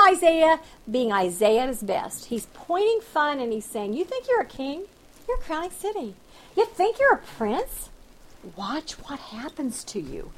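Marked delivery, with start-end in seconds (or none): 3.9-7.9 sarcastic taunt. Retold or imitated. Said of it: "This is sarcasm". 8.6-10.3 whispered, soft taunt; menacing whisper. Retold or imitated. God whispering a warning to proud Tyre, menacing whisper. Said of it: "This is sarcasm".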